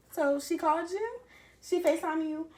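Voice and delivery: accusing. Retold or imitated. Confronting boyfriend about call.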